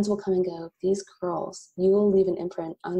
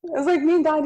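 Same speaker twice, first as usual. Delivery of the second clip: high, loud laugh through words; laughing hard at the joke; real laughter; 12 semitones higher, 6 dB louder than usual. Real, not acted. Agreeing with the host's joke, laughing hard.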